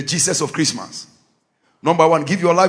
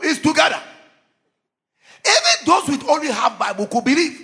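preaching at full force; 8 semitones higher than usual. Real, not acted. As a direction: proclaim Jesus to the whole church. Shouted preaching climax.

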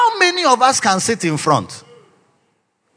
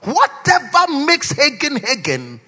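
retold heartfelt cry; 6 semitones higher, slower than usual. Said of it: "I cried in my heart".

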